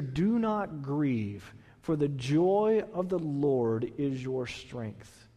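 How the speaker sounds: slow, soft, drawn-out verse; slow tender reading. Shown as a demonstration. Pastor repeating the verse softly to sufferers, slow and tender. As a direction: ease each word out gently. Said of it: "It says here".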